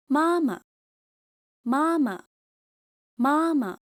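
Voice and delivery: each "妈妈" high and loud, then light and short; neutral second syllable. Shown as a demonstration. Teacher drilling for learners to repeat, light ending. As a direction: stress the first half of "妈妈", drop the second. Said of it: "second 妈 is light and unstressed".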